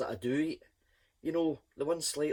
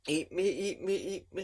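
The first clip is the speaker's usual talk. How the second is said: lightly husky, coaxing whisper-ish chant; a hint of a seductive sausage; husky hot dog voice; 3 semitones higher than usual.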